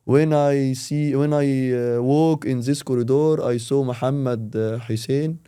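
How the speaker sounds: slow, halting English with pauses; slow halting English demo. Shown as a demonstration. Learner describing his day slowly. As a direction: search for each word, slowly. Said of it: "أوصف يومك".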